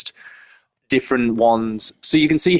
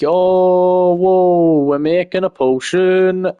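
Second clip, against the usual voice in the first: mock excitement; 8 semitones higher, 4 dB louder, much slower than usual. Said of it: "that whole gimmick of".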